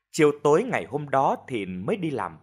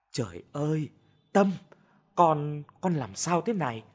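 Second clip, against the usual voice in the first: tearful worry; 3 semitones higher than usual. A narrator's reading. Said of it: "vừa khóc vừa hỏi han".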